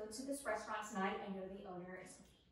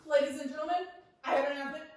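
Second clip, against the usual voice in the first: mock flight attendant; 3 semitones higher, 7 dB louder, much faster than usual. Mimics cabin announcement.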